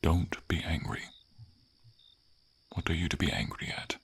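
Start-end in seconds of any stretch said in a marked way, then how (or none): none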